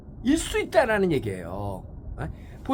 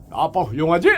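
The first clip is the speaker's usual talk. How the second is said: proud dad; 7 dB louder than usual. Retold or imitated. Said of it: "이렇게 훌륭한 척을 하는 거지".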